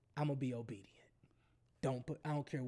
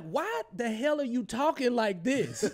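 high, loud, exasperated retelling; loud mock protest; 8 semitones higher, 9 dB louder than usual. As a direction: blurt it out against all the hushed secrecy. Mock outburst at whispering colleague.

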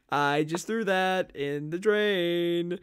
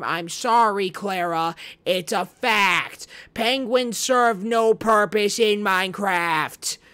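loud and slow, higher, drawn out for emphasis; loud mock apology; 4 semitones higher, 8 dB louder, slower than usual. Real, not acted. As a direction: declare it slowly and boldly. Loud declaration to Clara.

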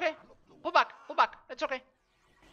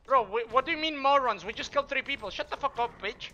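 real irritated shouting, higher and louder; angry yelling; 5 semitones higher, 5 dB louder than usual. Real, not acted. Streamer yelling at a teammate who called them morons, angry and loud. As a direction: snap back hard at the teammate who insulted you.

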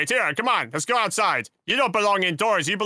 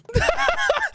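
high laugh. Acted. After acted scream.

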